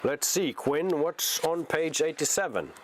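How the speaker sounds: ordinary delivery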